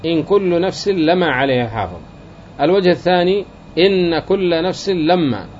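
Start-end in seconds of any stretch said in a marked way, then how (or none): 0.1-2.0 "إن" and "لما" both light, without doubling: "إنْ كل نفس لَمَا"; light reading. Shown as a demonstration. Teacher reciting the first reading to students, both words light. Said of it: "الوجه الأول التخفيف في الاثنين". 3.8-5.5 slow, with the nun of "إن" and the mim of "لما" both doubled: "إنَّ" and "لمّا"; doubled reading. Shown as a demonstration. Teacher reciting the second reading to students, both doubled. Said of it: "تشديد الاثنين".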